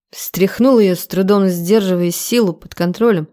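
plain reading voice

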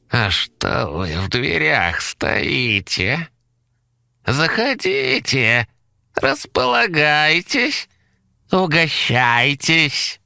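very high, slow sugary squeak; pleased squeaky monster. Acted. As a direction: invite them in with a sweet squeak. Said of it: "довольно заулыбавшись".

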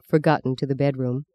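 ordinary delivery, nothing marked

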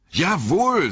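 emphatic yes. A narrator's reading. Frodo confirms his name eagerly.

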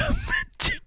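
short, high squeaky giggle. Real, not acted. Host laughing off the caller's trouble, giggle.

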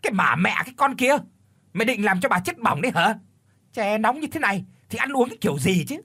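high, fast, loud shrieking rage; screeching yell. A narrator's reading. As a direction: shriek at the maid like a stuck pig. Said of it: "gào như là chọc tiết lợn". (gào thét the thé, nhanh và to)

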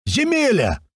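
high, stretched holler; joyful yell. A narrator's reading. Strike shouts from the back to Lyokha, joyful yell. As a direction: holler to a long-lost countryman. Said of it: "заорал Страйк откуда-то сзади".

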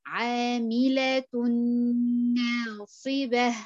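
"عاملة ناصبة" very slow, tanween merged into noon with long nasal hold; teacher models "عاملة ناصبة" slowly; slow ghunna. Shown as a demonstration. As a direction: stretch the "تن" into "ناصبة". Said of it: "أقرأها أقول إيه؟".

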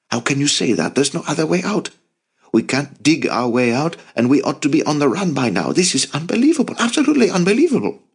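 high-pitched frantic outburst, speeding up toward the end; panicked rant. A narrator's reading. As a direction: build from protest to exasperated despair. Jacob rants in panic, trapped.

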